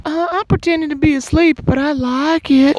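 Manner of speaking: very high falsetto character; mock woman's voice. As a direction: confess it in a put-on falsetto.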